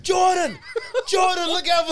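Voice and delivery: mom yelling. Retold or imitated. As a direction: shout a warning down the driveway. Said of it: "And the mom's like".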